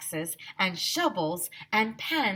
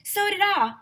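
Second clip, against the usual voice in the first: excited; 14 semitones higher, 6 dB louder than usual. A narrator's reading. Teacher joining in.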